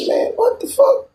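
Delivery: very high, slow squeal of disbelief; hammed-up incredulous squeak. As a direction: stretch it up into a shocked squeal. Hears they fought on the stairs.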